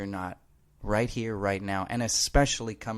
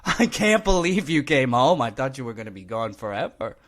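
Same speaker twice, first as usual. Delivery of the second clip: excited dog voice; 3 semitones higher, 6 dB louder than usual. Pretend. A dog greeting its owner home, overjoyed and loud. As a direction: play the dog beside itself with joy. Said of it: "your dog is like".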